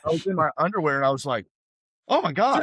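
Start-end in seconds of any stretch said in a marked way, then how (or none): 2.1-2.6 high, loud amazed exclamation; amazed gasp. Retold or imitated. Seeing his new body in the mirror, amazed high exclamation. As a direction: gasp at your own reflection.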